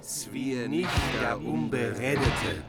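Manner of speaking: ordinary delivery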